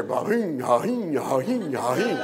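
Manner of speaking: imitating a sputtering engine that won't quit, lightly voiced; dying car engine. Speaker recalls the broke family car dieseling, imitated engine noise. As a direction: mimic an old Volkswagen coughing after it's switched off. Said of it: "you'd hear".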